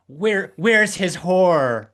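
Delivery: imitating Meredith Baxter's rage; furious accusation. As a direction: drag out a hammed-up jealous rage. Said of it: "just being like".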